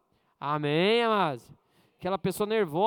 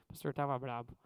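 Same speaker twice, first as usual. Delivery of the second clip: much softer, lower, sincere apology; humble apology; 8 semitones lower, 10 dB quieter than usual. Retold or imitated. Pastor retelling his apology to Carla, soft and humble. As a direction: say sorry gently and meekly.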